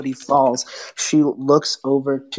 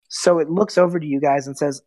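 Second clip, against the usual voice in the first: usual speaking voice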